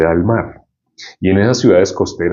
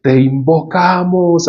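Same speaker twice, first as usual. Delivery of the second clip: high, slow mock chant; séance sorcerers; 9 semitones higher, slower than usual. Sorcerers holding hands at a séance, high mock chant. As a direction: hold the vowels long like a séance invocation. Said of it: "los brujos hacen así como sesiones de espiritismo".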